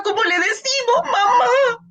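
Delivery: high, frightened cry of mamá; scared call. Shown as a demonstration. Therapist modelling a frightened call to the mother. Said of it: "si la mamá está asustada".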